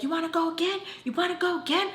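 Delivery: high-pitched mocking dare; taunting challenge. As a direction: goad them in a high, rising voice. Retold challenge in a fight.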